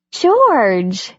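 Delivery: surprised to find him home. Acted. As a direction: caught off guard, blurt the name. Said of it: "she said, surprised".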